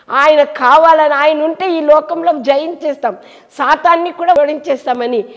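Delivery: loud, high, fervent preaching; preaching at full force. Real, not acted. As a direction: drive it home to the congregation. Preacher building to a climax. (బిగ్గరగా ఉత్సాహంగా బోధించడం)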